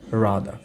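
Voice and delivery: "rather" as RAA-dhuh, ending in a schwa; silent final r. Shown as a demonstration. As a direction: say "rather" low with a long a and no closing r. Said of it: "শেষের রটা কিন্তু শোনা যাচ্ছে না". ("rather"-এর শেষের র বাদ দিয়ে বলা)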